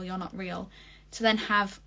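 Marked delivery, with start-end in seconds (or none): 0.0-0.7 you're not real trailing off quietly; a frightened murmur, dropping away; half-hearted scared retelling; fading mock panic. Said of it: "they start freaking out".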